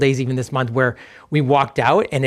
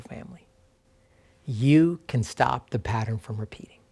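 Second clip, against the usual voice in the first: slow reflective reading. Real, not acted. Pastor offering truths to remember, slow and reflective. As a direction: read slowly and let the final words fall almost to a whisper. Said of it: "I'm just gonna read them slowly".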